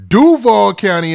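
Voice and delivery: DUVAL drawn out high and loud; hometown-hype holler on "Duval County"; emphatic shout-out. Real, not acted. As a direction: hit "Duval" hard and high, then drop through "County". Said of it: "I always gotta say it like that".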